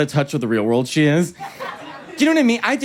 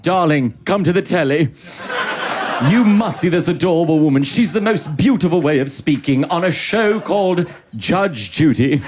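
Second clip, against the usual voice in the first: posh British couple. Retold or imitated. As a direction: fawn over her in plummy British English. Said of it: "British couple at home who's like".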